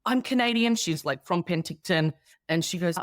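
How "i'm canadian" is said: excited gush. Retold or imitated. New Canadian vet gushing at the Australian nurse, excited and high. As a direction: squeal it like meeting a fellow countryman.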